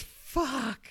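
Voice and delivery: high mock yell of a curse; imitated yell. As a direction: mimic the golfer's frustrated cry. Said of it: "he goes".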